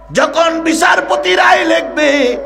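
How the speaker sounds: high, slow declamation; impassioned preaching. Acted. Preacher building up to the judge's verdict, high drawn-out declamation. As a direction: hold it high and stretch it out.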